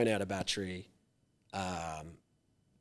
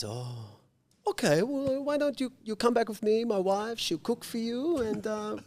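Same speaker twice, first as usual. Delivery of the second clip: put-on high, kindly Thai-accented offer, much higher than own voice; imitating Thai accent; 12 semitones higher, 4 dB louder than usual. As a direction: play Johnny offering a home in a Thai accent. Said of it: "I just gotta do his accent".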